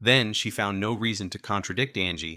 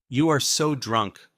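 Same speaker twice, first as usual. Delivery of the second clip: teasing accusation; 5 semitones higher, 6 dB louder, slower than usual. Acted. Friend teases her by phone.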